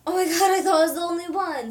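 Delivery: excited, fast and loud; quick excited gush; gushing chat read-out. Retold or imitated. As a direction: rattle it off like a thrilled fan. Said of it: "says Shil".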